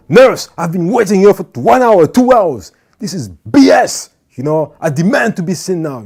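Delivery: loud, high demanding complaint; angry patient. Retold or imitated. Patient shouting at the nurse over the long wait, loud and high. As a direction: yell it like a fed-up patient who has waited hours.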